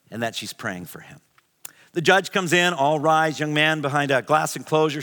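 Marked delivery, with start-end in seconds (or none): 0.1-1.2 whispered. Retold or imitated. Retelling mom's gentle message.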